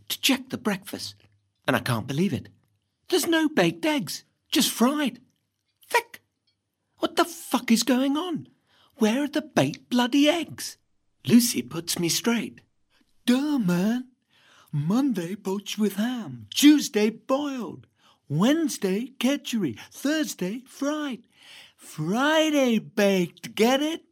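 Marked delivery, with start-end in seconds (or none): 3.1-10.8 higher, indignant disbelief at the breakfast; outraged. Acted. Boy indignant at the sideboard, outraged. 13.3-24.0 slow, drawn-out stoner voice listing days; slow hippie sister. Acted. Sister Lucy explains breakfast days, slow hippie drawl.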